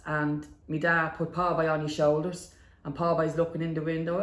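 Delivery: ordinary talk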